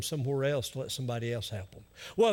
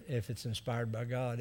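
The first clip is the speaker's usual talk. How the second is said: ordinary talk, nothing marked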